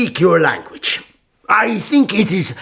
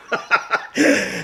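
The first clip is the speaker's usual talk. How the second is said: another quiet amused laugh; soft breathy chuckle; 8 semitones higher, 4 dB quieter than usual. Acted. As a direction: let a small pleased laugh escape. Sultan amused at prisoner's answer.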